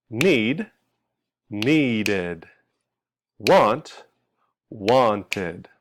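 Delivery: "needed" and "wanted" stretched into two falling syllables; short "need", then drawn-out "nee-did", likewise "wan-tid"; extra ID syllable. Shown as a demonstration. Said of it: "adding an extra syllable".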